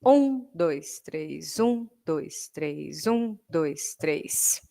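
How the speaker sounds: "Um" accented, "três" fading almost to a whisper; strong, medium, very soft in threes; ternary count. Shown as a demonstration. Teacher counts triple meter, accent on one. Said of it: "ternário".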